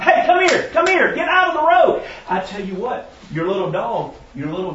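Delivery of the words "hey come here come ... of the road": high loud calls of come here; yelling at dog. Retold or imitated.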